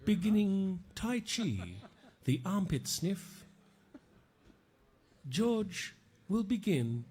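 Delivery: slow, hushed nature documentary narrator; soft wildlife-film narration; David Attenborough. Pretend. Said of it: "I'm gonna do the David Attenborough".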